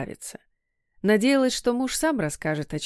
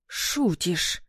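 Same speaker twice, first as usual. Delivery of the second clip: stunned gasp; 2 semitones lower than usual. A narrator's reading. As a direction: gasp it out, taken aback. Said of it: "охнула мама".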